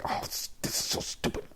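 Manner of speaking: fast, whispered frustrated grumble; quick exasperated whisper; irritated mutter. Retold or imitated. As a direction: hiss it under your breath in annoyance. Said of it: "Do I get aggressive?".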